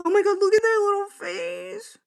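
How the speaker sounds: high-pitched gushing; squealing over cuteness. Real, not acted. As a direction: coo at a cute animal picture. Sees raccoon photo.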